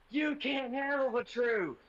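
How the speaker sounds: high, slow dramatic quote; hammed-up movie quote shout; movie line yell. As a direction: belt it like a courtroom drama. Quoting famous movie line at teacher.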